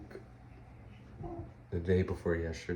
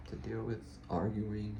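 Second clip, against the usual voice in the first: normal talking voice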